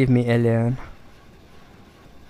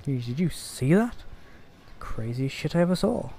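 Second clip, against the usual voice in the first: normal talking voice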